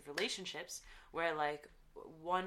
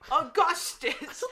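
mocking; 11 semitones higher, 12 dB louder, much slower than usual. Mocking a disliked character.

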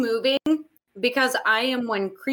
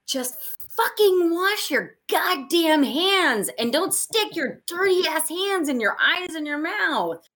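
exasperated rant; 7 semitones higher than usual. Real, not acted. Ranting about covid hygiene.

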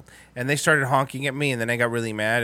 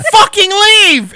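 high, loud, drawn-out yell; furious shout; 10 dB louder, much slower than usual. Retold or imitated. Driver ordering the old man away, furious shout. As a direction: bellow the retold order, stretched out. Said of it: "I was really mad".

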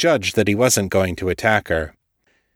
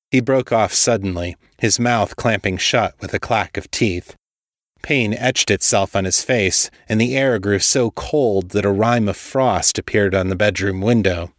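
ordinary delivery

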